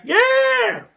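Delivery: high squeal of triumph; excited yelp. Real, not acted. Celebrating his own rhyme.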